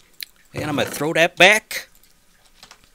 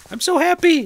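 very high, louder gleeful squeal; excited squeal; 5 dB louder than usual. Real, not acted. Gamer squealing happily about her new field, high and delighted. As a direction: burst out with delight.

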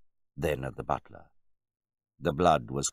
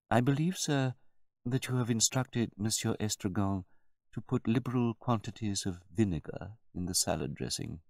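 higher-pitched, measured servant; deferential butler; 7 semitones higher than usual. A narrator's reading. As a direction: answer the master with careful politeness. Butler explains to his master.

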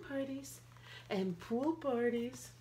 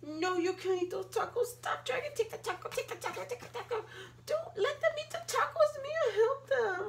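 high, urgent pleading; much higher frantic plea; panicked; 11 semitones higher, 4 dB louder, faster than usual. Acted. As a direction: beg them to stop in a panic.

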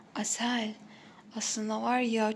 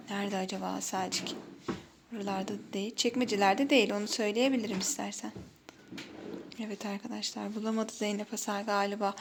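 normal talking voice

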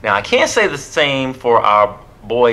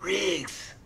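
high, hushed impression of a movie cop; hushed, high-pitched celebrity impression; Danny Glover; 7 semitones higher, 11 dB quieter than usual. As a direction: softly call out in a high put-on voice. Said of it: "that's my Danny Glover impression".

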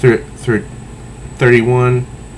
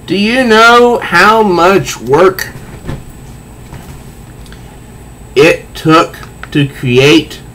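indignant Riddler; 5 semitones higher, slower than usual. Acted. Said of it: "The Riddler says".

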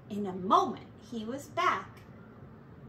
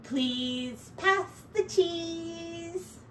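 very slow, drawn out; slow finish; 6 semitones higher, much slower than usual. A narrator's reading. Narrator reads Ratty's last line to end the tale. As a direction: stretch every word to close the story. Ending line, slowly read.

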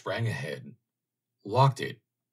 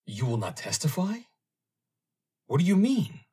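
rising to a high, loud shocked demand; a rattled underwriter's high cry; 10 semitones higher, 4 dB louder than usual. Acted. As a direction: push him for an answer, alarmed. Meyer shocked by refusal.

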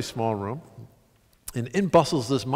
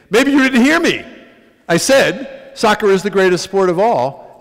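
loud, raised, insistent correction; scolding; 5 semitones higher, 8 dB louder than usual. Retold or imitated. Monk shaking his finger in his face, scolding. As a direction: lay down the law, finger-wagging. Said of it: "shaking his finger in my face".

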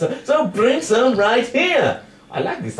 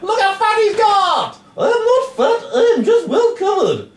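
high bickering character; 11 semitones higher than usual. Acted.

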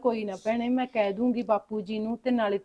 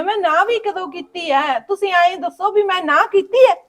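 protesting with a nervous laugh; 7 semitones higher, 6 dB louder than usual. Acted. Sister-in-law protests innocence.

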